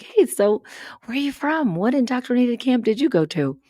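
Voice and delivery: high, bright mock-friendly question; cheery tour greeting. Greeting strangers on her tours, mock-cheery and high. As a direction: greet them like an eager host. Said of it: "I'm like".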